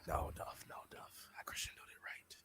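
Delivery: a quick hushed whisper to his co-host; whispered aside. Real, not acted.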